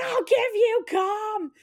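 high, slow, drawn-out threat; hammed-up angry retort; mock furious wife. Wife turning on her husband in the water, high mock fury.

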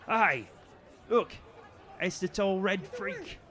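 put-on high, loud jeering young guard. Young guard mocks Barb before the market crowd, high jeering call. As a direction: sneer it at her for the crowd.

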